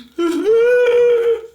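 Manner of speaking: high, loud wailing sob; a distant eerie wail; wailing cry. A narrator's reading. Strange little noise.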